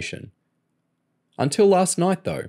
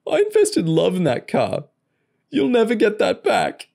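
high, tearful whine; weeping crook refusing to be consoled; sniffling; 13 semitones higher, 3 dB louder than usual. A narrator's reading. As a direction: sniff through the protest. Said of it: "Joe sniffled".

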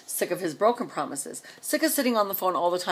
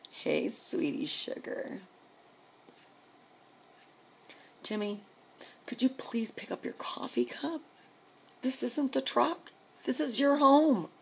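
soft, higher sweet-talking voice; sugary mock-sweet request; 6 semitones higher, 6 dB quieter than usual. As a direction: coax your husband with exaggerated sweetness. Acting out sweet request to husband.

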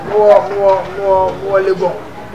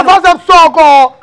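heated outburst; 8 semitones higher, 5 dB louder, slower than usual. Acted. Heated argument between friends.

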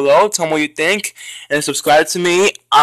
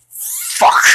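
squeaky fuck. Real, not acted. Raging at a hard game.

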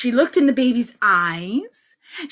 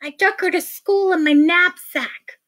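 put-on higher, emphatic kid voice; confessing boy; 7 semitones higher than usual. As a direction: explain it like a child. Ben confesses to mother.

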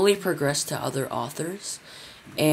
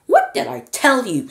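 much higher, louder crone voice; shrill old woman; 11 semitones higher, 8 dB louder than usual. A narrator's reading. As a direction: squawk it like a cross old woman. Grandma complains.

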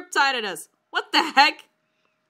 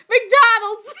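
high squeal through laughter; helpless high-pitched giggling; laughing; 10 semitones higher, 4 dB louder than usual. Real, not acted. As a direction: crack up while saying it. Reader cracking up at own story.

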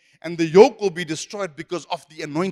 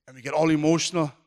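ordinary talk, nothing marked

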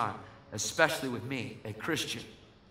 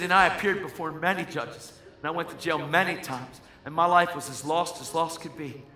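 raised, forceful preaching voice; retold courtroom plea, preached out loud; impassioned testimony; 4 semitones higher, 7 dB louder than usual. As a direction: testify to the whole court, building in volume.

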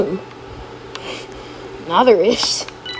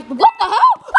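high-pitched startled yell; shocked shout; 10 semitones higher, 4 dB louder than usual. Real, not acted. Player caught off guard mid-explanation, shocked. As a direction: blurt it out in sudden shock.

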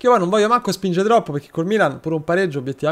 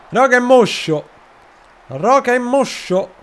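mock lament; 7 semitones higher, 4 dB louder than usual. Real, not acted. Complaining the player is sluggish.

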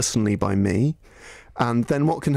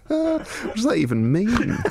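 laughing disbelief; 10 semitones higher than usual. Real, not acted. Baffled by a tweet.

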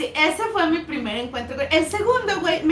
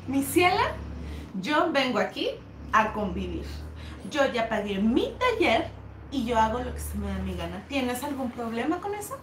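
imitated haughty defiance; 4 semitones lower, 5 dB quieter than usual. Elida telling off the teacher, imitated haughty defiance.

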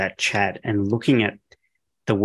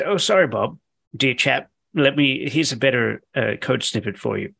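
higher, jokey put-on British accent for the chatbot; a light, hammed-up British butler voice apologising; mock British accent; 4 semitones higher than usual. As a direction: play the AI as an overly polite British gent. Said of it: "I imagine it has a British accent".

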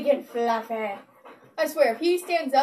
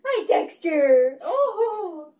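slow, louder, near-crying hello; teary joyful greeting; 2 semitones higher, 6 dB louder, much slower than usual. Real, not acted. Owner greets her dog teary with joy. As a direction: greet him as if about to cry.

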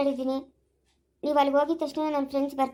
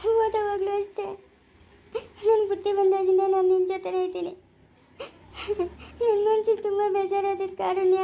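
higher-pitched, crying confession; tearful; 4 semitones higher than usual. Acted. Parting from a cousin who was always a friend, tearful. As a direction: speak through tears, voice raised in pitch. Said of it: "ನೀನ್ ಅಳ್ಬೇಡ".

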